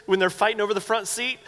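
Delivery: ordinary talk, nothing marked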